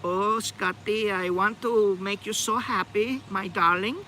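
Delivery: put-on high, drawn-out Danish-accented sweet talk; bad Danish accent. Imagined Danish blonde sweet-talking her darling, bad Danish accent. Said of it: "It's a bad accent".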